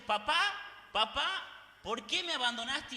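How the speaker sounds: slow, pleading cry of abandonment; Jesus on the cross crying out in anguish; desperate anguished cry. Acted. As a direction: cry out to a father in despair, drawn out. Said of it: "gritando, desesperado, angustiado, derramando lágrimas".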